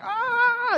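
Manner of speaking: sudden high yelp of sourness; shout. Retold or imitated. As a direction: yelp as if the candy is too sour. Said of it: "so she's like".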